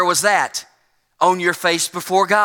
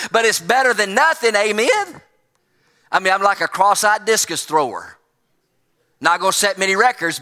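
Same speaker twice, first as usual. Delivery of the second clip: ordinary talk, nothing marked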